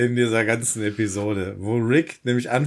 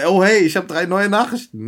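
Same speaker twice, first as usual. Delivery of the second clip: erfreuter Rick; 7 semitones higher, 4 dB louder than usual. Retold or imitated. Rick hört seine Mailbox ab.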